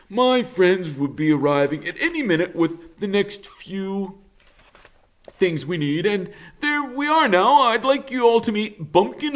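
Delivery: high, bouncy beaver voice, slowing at the end; a confident helper taking charge; cheerful beaver. Acted. Mister Beaver reassuring the robot, high and bouncy.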